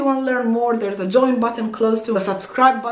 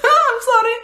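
high, louder laugh-through apology; 13 semitones higher, 4 dB louder than usual. Real, not acted. Admitting she didn't know the country, laughing apology.

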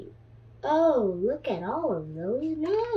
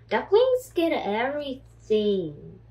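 ordinary delivery, nothing marked

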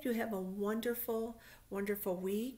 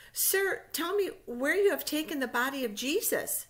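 pleading Mary; 8 semitones higher, 8 dB louder than usual. Acted.

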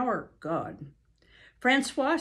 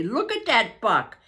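much higher, louder gleeful exclaiming; Francois crowing over Buck, higher and louder; gleeful French-Canadian; 9 semitones higher, 6 dB louder than usual. Acted. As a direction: crow over the dog with delight. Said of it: "Francois cried".

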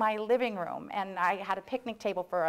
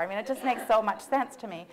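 ordinary talk, nothing marked

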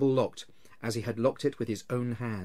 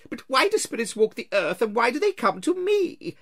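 put-on higher, louder, pleading old man; frightened Scrooge; 12 semitones higher, 8 dB louder than usual. Scrooge on his knees begs the ghost, frightened. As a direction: beg the apparition to leave you be.

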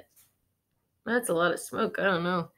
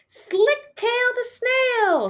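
much higher, louder, slow announcing; 8 dB louder, slower than usual. Acted. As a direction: present the name proudly. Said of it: "said Holly".

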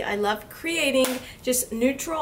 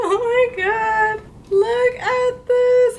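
gushing, high and loud; 10 semitones higher, 6 dB louder, slower than usual. Real, not acted. Gushing over packaging.

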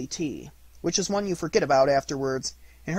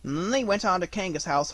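ordinary delivery

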